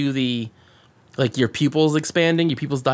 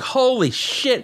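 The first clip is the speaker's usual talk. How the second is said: higher, slow gasp of awe; stunned holy shit; 9 semitones higher, slower than usual. Retold or imitated. Viewer reacting to the villain's taunt, stunned and high. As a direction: slowly marvel at it, way up high. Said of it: "And you're just like".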